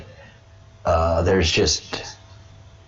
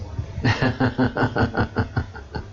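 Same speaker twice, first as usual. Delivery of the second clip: breathy laugh; 3 semitones higher, 4 dB quieter than usual. Real, not acted. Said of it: "laughs after bit".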